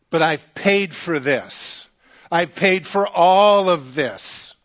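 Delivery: slow, emphatic acted outrage; mock indignant protest. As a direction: drag out each word in protest. Fuming father protests to staff.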